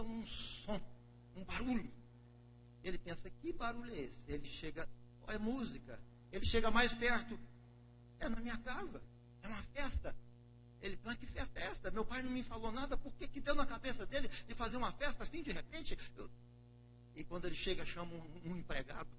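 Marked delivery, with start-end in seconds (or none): 3.3-4.2 voiced a bit higher, wondering; puzzled older brother. Oudere broer hoort muziek. 5.3-10.2 voiced a bit higher, wondering; puzzled older brother. Oudere broer nadert huis. 11.0-16.0 higher, questioning the sudden party; indignant older brother. Acted. Oudere broer verbaasd.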